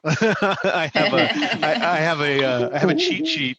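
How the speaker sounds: high, loud words breaking through laughter; laughing confession. Real, not acted. Learner owning up to a cheat sheet, laughing. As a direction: laugh hard while trying to get it out. Admits her crib notes, laughing.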